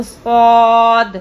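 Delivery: سواد drawn out slowly with a full, heavy ص; full heavy saad. Shown as a demonstration. Teacher shows students a heavy, full سواد. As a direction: hold سواد long and thick in the mouth. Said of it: "سواد کو ہمیشہ پر ادا کیا جاتا ہے".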